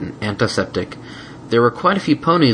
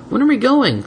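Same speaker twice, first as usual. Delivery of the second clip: calling out; 4 dB louder than usual. Acted.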